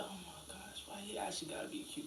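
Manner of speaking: a hushed mock whisper; whispered gush. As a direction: breathe it like a secret compliment. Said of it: "people used to come up and be like".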